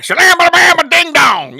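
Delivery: loud, high, slow garbled gibberish; mock rock-chewing mumbler. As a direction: imitate a neighbour talking through a mouthful of rocks. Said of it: "it would sound like he was chewing rocks".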